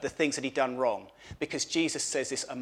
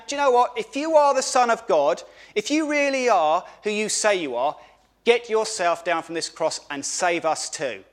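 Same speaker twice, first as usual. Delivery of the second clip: taunting, higher and louder, sneering at Jesus; mocking criminal; 5 semitones higher, 5 dB louder than usual. Criminal taunting Jesus on the cross, mocking. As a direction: jeer at him like the criminal on the cross.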